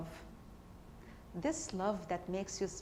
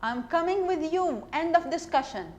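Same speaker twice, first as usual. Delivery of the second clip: loud, higher, no arguing; firm insistence; 7 semitones higher, 7 dB louder than usual. Acted. To her friend before the abortion: loud firm insistence. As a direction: put your foot down, loudly.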